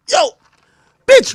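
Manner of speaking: ordinary talk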